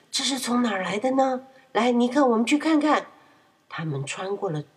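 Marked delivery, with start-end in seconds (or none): 0.1-3.1 high, loud surprised call. A narrator's reading. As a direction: spots the overturned boat. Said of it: "波利很吃惊地说". (吃惊地说)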